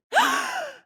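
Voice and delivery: sudden high intake of breath; sharp gasp. Acted. Explorer struck by the monolithic city, gasping sharply. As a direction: gasp in shock.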